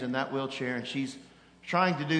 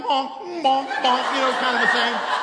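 high, loud laughing bonk bonk like a cheap horn; honking horn imitation; 8 semitones higher, 7 dB louder than usual. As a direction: honk it out like a tiny car horn. Imitating car horn while driving.

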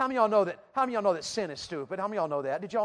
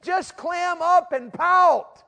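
loud, high, drawn-out goading; sin urging a sulk, pitched high and loud; 8 semitones higher, 10 dB louder, slower than usual. Acted. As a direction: egg them on at a shout. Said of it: "and sin says".

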